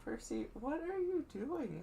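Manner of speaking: high, soft pet-talk voice. Real, not acted. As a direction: coo at the cat. Talking to the cat.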